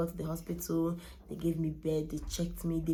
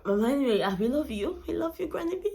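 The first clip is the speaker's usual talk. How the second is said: higher and louder, calling out lovingly; tribute; 9 semitones higher, 6 dB louder than usual. Real, not acted. Granddaughter calling out love to her late grandma, higher.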